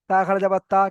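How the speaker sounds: তা খাড়া যাবার তা with a short last তা; the wrong way: final তা left unstretched; no stretch while spelling. Shown as a demonstration. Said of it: "শেষে গিয়ে টান করছি".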